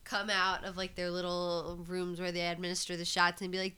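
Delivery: normal talking voice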